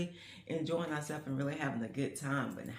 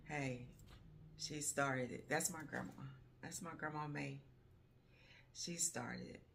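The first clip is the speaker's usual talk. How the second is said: choked-up pride; 9 dB quieter than usual. Real, not acted. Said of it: "before I can't get emotional".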